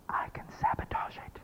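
a breathless whispered confession; whispered aside. Acted. Speaker voicing the audience's inner saboteur, whispered. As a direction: whisper it like a guilty secret.

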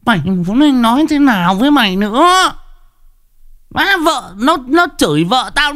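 very high, wailing rant; aggrieved outburst. Real, not acted. Streamer protesting that his wife and sister got cursed, aggrieved wail. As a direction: let the complaint pour out at the top of your pitch. Rants about family being cursed at.

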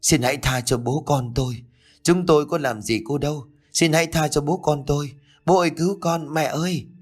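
high-pitched pleading, not full-volume; a child begging for rescue from a nightmare; narrated frightened cry. A narrator's reading. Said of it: "quấy đạp dữ dội và hét lên".

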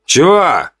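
short, high-pitched testy reply; snapped back. A narrator's reading. Hunter answering his chief's call, snapping back. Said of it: "огрызнулся охотник".

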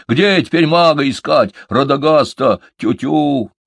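high, loud, flustered questions; agitated. A narrator's reading. Малыш despairs of finding the wizard, agitated. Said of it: "взволновался малыш".

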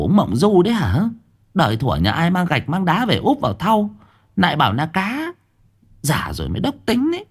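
high, scolding wife voice; exasperated wife. Acted. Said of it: "nhìn chồng trợn mắt". (bà vợ trợn mắt mắng chồng, giọng cao)

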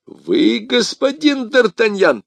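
high, drawn-out outburst; insistent exclamation. Acted. Commissar insists Athos is D'Artagnan, exclaiming. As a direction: pitch the name up insistently.

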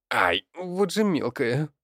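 high, slow cry of pain; yelp, then grumble at the kicker. A narrator's reading. Leonid kicked by the girl, pained and annoyed.

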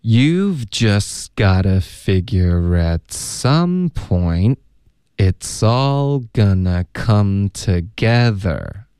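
very slow, stretched linked phrases; drawn-out teacher's demonstration, pausing mid-sentence; slow model reading. Shown as a demonstration. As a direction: read it at half speed for learners. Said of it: "那还要请老师多读几遍".

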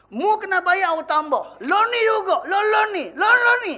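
put-on Kelantan accent, building to a high, loud shout on lo ni. Angry creditor demands payment now, shouting in Kelantan accent. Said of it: "dia tak cakap bahasa Kelantan lah".

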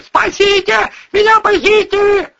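high desperate scream for help; shrill scream. Pretend. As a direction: scream for help at the top of her lungs. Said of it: "истошно завопив".

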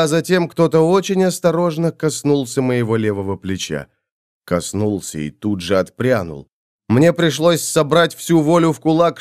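ordinary delivery

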